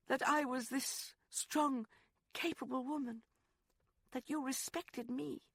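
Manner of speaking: put-on high young woman's voice, pained; Rosalind, hurt. Woman tells lover how he made her feel, high and hurt. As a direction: tell him what really hurt.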